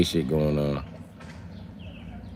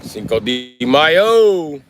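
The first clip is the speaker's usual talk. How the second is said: slow, loud, higher festive call; drawn-out holler; 7 semitones higher, 5 dB louder, much slower than usual. Real, not acted. Streamer calling out Cinco de Mayo, drawn-out holler. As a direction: holler it long and high.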